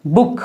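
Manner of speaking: "बुक" with a short, quick oo. Shown as a demonstration. Teacher giving the correct reading of book, short "बुक". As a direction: keep the vowel in "बुक" short. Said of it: "तो क्या हो जाएगा?".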